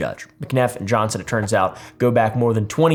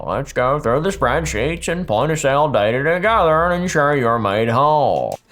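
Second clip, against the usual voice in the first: exaggerated sing-song Utah accent. Host reading the executive's offer straight to Brian, in a put-on Utah accent. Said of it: "That's my best Utah accent".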